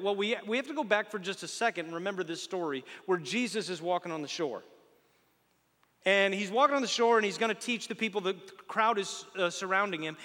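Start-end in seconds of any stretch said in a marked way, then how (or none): none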